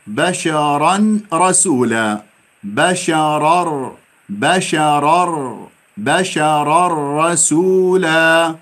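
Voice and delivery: بشرا رسولا slow, nun merged into ra; slow recitation. Shown as a demonstration. Teacher shows where the nun went. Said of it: "وين النون؟".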